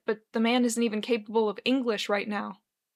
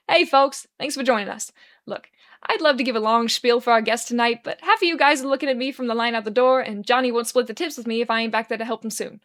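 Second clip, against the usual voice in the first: loud, brisk emcee intro; rally the room like an emcee; stage announcement; 5 dB louder than usual. Acted. Bartender on the mic introducing the pianist, loud.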